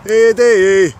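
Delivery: high, loud call with a long falling end. Real, not acted. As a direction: shout his name after him as he flies. Calling after seagull in flight.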